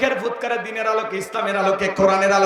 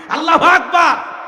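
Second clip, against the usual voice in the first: high, loud cry of praise; ringing takbir; loud exclamation; 8 semitones higher, 7 dB louder than usual. Real, not acted. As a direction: proclaim it out to the crowd. Exclaims praise before crowd.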